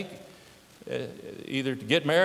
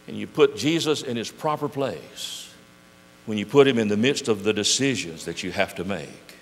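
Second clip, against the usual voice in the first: ordinary talk, nothing marked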